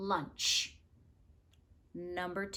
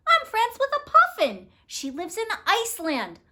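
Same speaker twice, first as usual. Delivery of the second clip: higher and louder, bursting with pride; thrilled bird; 11 semitones higher, 6 dB louder than usual. Acted. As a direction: squeal it like a giddy little bird. Birdie thrilled about puffin friend.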